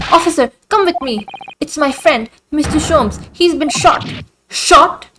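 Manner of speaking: high-pitched, urgent appeal; urgent alarm. Acted. Telling the officer a friend was shot, alarmed.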